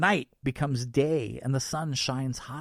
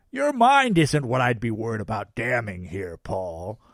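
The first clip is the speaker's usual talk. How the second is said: Santa voice; 6 dB louder than usual. Acted. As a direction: play a cheerful Santa. Santa speaks.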